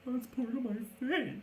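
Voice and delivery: high-pitched panicked wail; freaking out. Acted. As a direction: wail it like a horrified, wounded captive. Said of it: "freaking out".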